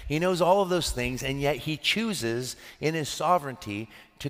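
pitched high, pressing each line home; impassioned preaching. Acted. Pastor to congregation, rising preaching fervour. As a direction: build the sermon to a peak, hammering knows.

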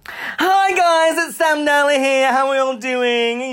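very high singsong, dissolving into babble; mocking co-host impression. Co-host takes his turn impersonating host, high mocking squeal. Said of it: "you do me then".

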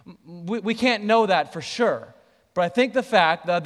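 ordinary talk

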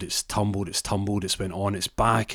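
ordinary delivery, nothing marked

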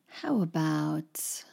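how about whispered, slow. Real, not acted. Teacher whispering while she thinks of an example. Whispered repeat mid-lesson.

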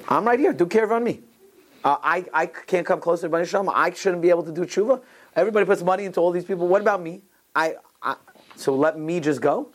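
high-pitched, insistent imitation of an aggrieved man; earnest retold plea. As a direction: plead your own case, pitched well up. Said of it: "he meant, like, a hundred percent".

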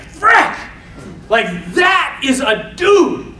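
sudden high, loud exclamation; high-pitched yelp of disbelief; shocked outburst. Acted. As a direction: burst out in mock amazement. Acting out the onlooker's shocked reaction.

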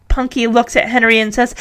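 plain reading voice

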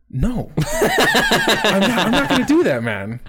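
high, laughing mock refusal. Storyteller voicing the refusal he never gave his coworker, laughing. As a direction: refuse through laughter, pitch jumping up.

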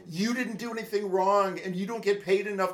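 retold frustrated rant. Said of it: "I'm like".

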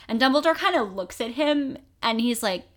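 ordinary delivery

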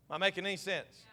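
ordinary talk

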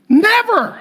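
very high comic exclamation; mock outraged refusal. Pastor refuses the shopper's repayment, high mock outrage. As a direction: squeal the refusal in mock horror.

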